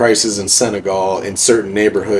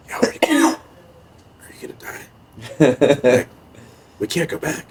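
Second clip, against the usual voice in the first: mock scared whisper; 6 semitones higher, faster than usual. Juice frightened, retold.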